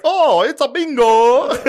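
high, loud, slow smug announcement; imitating himself gloating over a win; mock gloating. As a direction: ham up a smug winner. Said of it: "would've won and be like".

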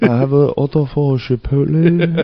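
high, put-on snooty voice through laughter; mock posh student. Friend mocking the rude student, snooty put-on voice. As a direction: parody the entitled customer. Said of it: "What the fuck are you doing".

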